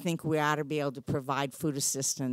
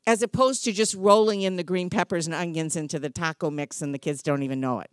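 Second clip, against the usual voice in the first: ordinary talk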